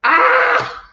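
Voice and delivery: loud, very high mock scream; imitated labor scream. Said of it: "while Trissy's going".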